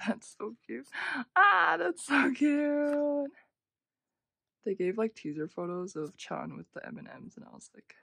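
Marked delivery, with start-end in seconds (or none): 1.4-3.3 loud, high, drawn-out gushing; delighted squeal. Real, not acted. Fan seeing a cute photo, delighted squeal. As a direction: cry out at unbearable cuteness.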